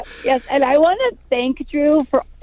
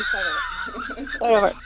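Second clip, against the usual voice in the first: laughing restart; 6 dB quieter than usual. Real, not acted. Restarting her song, laughing.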